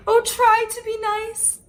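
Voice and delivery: high-pitched frightened plea; whimpered. Acted. Terrified lion begging the little mouse, whimpering. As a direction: beg like a big cat shrinking into a ball. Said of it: "he whimpered".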